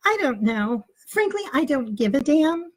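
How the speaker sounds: high, slow dismissive mother; fake mom voice. Teen's pretend mother dismisses the teacher, high and drawn-out. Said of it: "I got the voice down pat".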